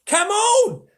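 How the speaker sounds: high, loud, gleeful beaver cry; delighted shout. Acted. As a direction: bounce with delight as you call. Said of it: "almost dancing with delight".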